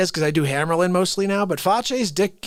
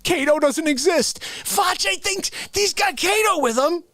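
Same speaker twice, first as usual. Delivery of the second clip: high-pitched incredulous comic rant; exasperated rant; 9 semitones higher than usual. Acted. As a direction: rant in mock disbelief, pitched way up. Exasperated rant about imaginary sidekick.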